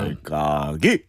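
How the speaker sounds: low, slow comedian voice; lightly voiced comedian; Choi Yang-rak impression. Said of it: "최양락 씨 생각나네요".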